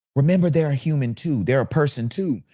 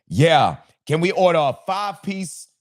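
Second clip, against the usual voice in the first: projecting; 4 semitones higher than usual. Shown as a demonstration. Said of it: "now I have to project my voice".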